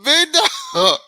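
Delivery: laughing loudly through it; a loud, laughing repeat of the joke. Real, not acted. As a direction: burst out laughing as you say it. Repeating the punchline, laughing hard.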